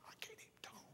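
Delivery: whispering, as if voice gone; voiceless whisper. Retold or imitated. Sick preacher answers the host preacher, voiceless whisper. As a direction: rasp it with no voice.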